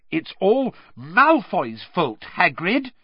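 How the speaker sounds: high, slow, emphatic reassurance; earnest. A narrator's reading. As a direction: insist earnestly, stretching the words. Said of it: "said Hermione earnestly".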